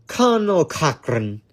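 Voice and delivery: put-on a poor stab at an Irish accent on the name; self-mocking failed Irish accent; bad Irish accent. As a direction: mangle the villain's name in a clumsy Irish accent. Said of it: "I cannot do an Irish accent".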